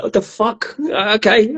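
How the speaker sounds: high-pitched, puzzled reaction; mock bafflement. Listener can't follow Irish relatives' speech, mock bafflement. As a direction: give up understanding in a high voice.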